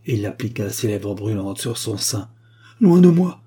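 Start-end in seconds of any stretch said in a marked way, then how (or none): 2.8-3.4 much higher and louder, pushing him away; a sharp cry to keep him off; cri de rejet. Acted. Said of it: "s'écria la marquise". (la marquise repousse le comte, s'écrie fort et aigu)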